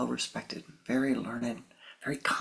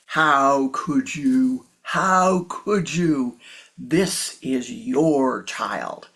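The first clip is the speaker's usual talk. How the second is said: loud, slow, outraged grandfather; loud slow accusing shout; furious accusation; 8 dB louder, much slower than usual. Acted. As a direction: hurl it at the monk, very slowly. Grandfather confronts monk.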